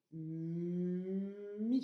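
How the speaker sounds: "mi" avec un long m tenu avant le i; son étiré. Shown as a demonstration. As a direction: faire durer le "m" de "mi". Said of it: "écrire le son". (« mi » très étiré, mmm puis i)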